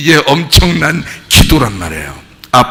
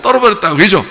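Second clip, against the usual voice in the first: ordinary delivery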